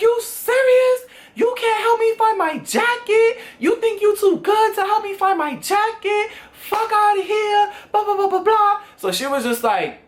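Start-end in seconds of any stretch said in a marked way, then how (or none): 0.0-8.8 high-pitched outraged rant; acted-out tirade, pitched way up; going off on a friend. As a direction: go off in a high, indignant squawk. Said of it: "When I tell y'all I went off".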